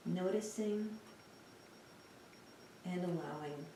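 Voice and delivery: soft, unhurried meditation voice with long pauses; guided meditation. Real, not acted. Teacher guiding listeners through a lying-down body scan, calm meditation voice. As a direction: guide them gently through a body scan, leaving space between phrases.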